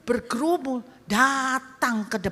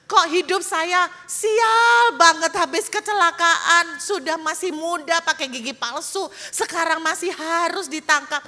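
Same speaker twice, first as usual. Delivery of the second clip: crying prayer; 8 semitones higher, 5 dB louder than usual. Acted. Young man in a cage cries out to God, wailing. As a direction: wail it like a man begging heaven. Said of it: "dan di dalam kurungan dia menangis".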